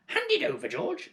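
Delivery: high, sneering hag voice; accusing old hag. Acted. Grandma accusing George of selfishness, high sneering hag. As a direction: jeer at the boy from above.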